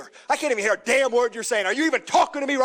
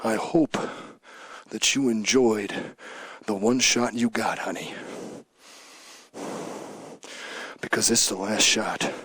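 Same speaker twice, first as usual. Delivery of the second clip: low menace; 12 semitones lower, 6 dB quieter than usual. Real, not acted. Champion quietly threatens Miss Jackie and Charlie Haas. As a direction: lean in close and threaten softly.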